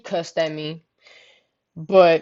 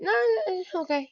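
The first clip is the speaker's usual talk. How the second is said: higher, softer mock reassurance; imitating cousin covering up; 11 semitones higher, 5 dB quieter than usual. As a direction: play her brushing it off, pitched up. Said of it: "I heard something in her voice".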